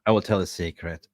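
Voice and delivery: ordinary talk, nothing marked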